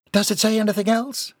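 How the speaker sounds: high-pitched, insistent wife; demanding. A narrator's reading. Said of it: "his wife demanded".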